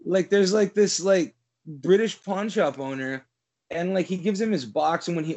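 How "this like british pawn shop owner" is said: ordinary talk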